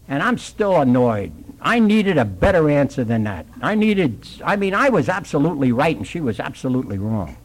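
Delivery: normal talking voice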